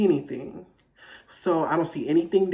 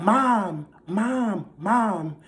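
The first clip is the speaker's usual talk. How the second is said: mild mock yell for mom, pitched up; retold yelling; 5 semitones higher, much slower than usual. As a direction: call out for mom, half-yelled. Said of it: "yelling. I'm like".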